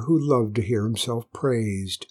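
ordinary delivery

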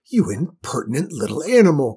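loud, outraged queen; indignant cry. A narrator's reading. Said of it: "cried the queen".

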